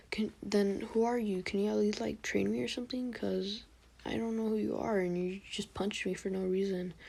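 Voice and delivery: imitated child, timid questioning; little kid Naruto. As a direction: plead lightly like a little kid asking for help. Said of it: "trying to make a little kid voice".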